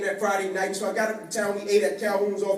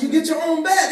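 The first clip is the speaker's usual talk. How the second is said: higher and louder, beaming; excited brag; 9 semitones higher, 7 dB louder than usual. Retold or imitated. Telling his friends on the phone, excited. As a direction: sell it to the boys back home.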